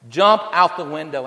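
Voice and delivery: loud call up to the window; father yelling. Acted. Father calls up to his trapped son, loud. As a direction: shout it up to a burning window. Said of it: "His father yelled back".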